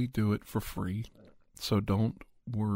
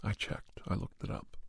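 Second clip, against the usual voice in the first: softly whispered joke; playful whispered aside; 7 dB quieter than usual. Real, not acted. Joking aside about catching colds.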